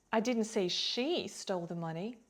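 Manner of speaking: "she" lifted and lengthened; emphatic "she", implying someone else; stress on SHE. Shown as a demonstration. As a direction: punch the word "she", ending on a rise. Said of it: "maybe another person did".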